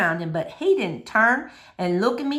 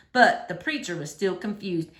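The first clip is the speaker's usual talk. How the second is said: ordinary delivery, nothing marked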